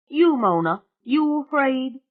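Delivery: put-on loud, high, brash country girl; a loud, high swamp witch bragging to the family. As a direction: belt it out like a cocky backwoods girl. [name]'s rowdy swamp girl voice.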